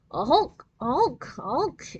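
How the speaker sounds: honking horn imitation; imitated horn blast. A narrator's reading. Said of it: "it blasted again".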